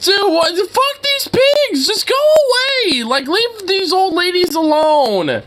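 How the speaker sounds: indignant high-pitched rant; outraged disbelief. Real, not acted. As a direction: shriek at the cops in comic fury. Outraged at cops arresting lady.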